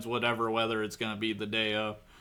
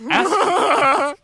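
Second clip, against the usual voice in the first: laughing shout; 7 dB louder than usual. Real, not acted. Laughing outburst mid-chat.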